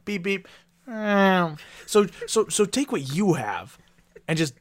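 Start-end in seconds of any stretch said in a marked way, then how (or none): 0.0-1.6 high, slow cartoon beep beep meow; silly high-pitched car and cat noises. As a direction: squeak it out like a toy car. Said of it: "it's just me going".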